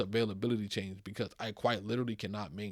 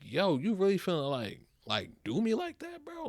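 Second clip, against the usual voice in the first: incredulous; 8 semitones higher than usual. Retold or imitated. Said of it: "I'm like".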